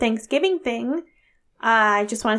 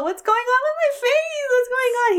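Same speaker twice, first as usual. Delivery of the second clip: very high, squealing mock outrage; highest pitched voice; 13 semitones higher than usual. Telling Jen about the guard, very high squeal. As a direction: squeal it out in disbelief. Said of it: "my highest pitched voice".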